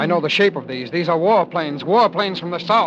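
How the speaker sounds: alarmed recognition of warplanes. Acted. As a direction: realize the danger and raise your voice.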